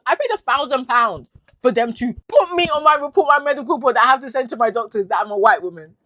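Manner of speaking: loud, high, furious outcry; outraged shout. Real, not acted. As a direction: raise the voice in real outrage. Outraged at careless medical care.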